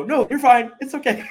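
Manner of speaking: very high, laughing soothing act; over-cheery parental reassurance. Retold or imitated. Dad plays down son's hurt tongue, over-bright reassurance.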